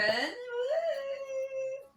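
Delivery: long high whoop; cheering whoop. Real, not acted. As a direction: let out a drawn-out high "woo". Cheering the guest's name.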